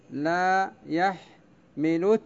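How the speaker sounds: لا يحملت drawn out very slowly, لا stressed, rising at the end; slow scansion. Shown as a demonstration. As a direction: beat out لا يحملت slowly for the class. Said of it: "إذن إلى هنا واضح؟". (لا يحملت ببطء شديد مع نبر لا)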